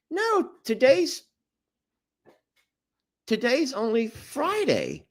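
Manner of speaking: high, halting imitation of mom; mock bewildered mother, hesitating; confused mom. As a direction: falter in mom's high-pitched voice. Voicing confused mother in story.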